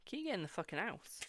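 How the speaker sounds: ordinary delivery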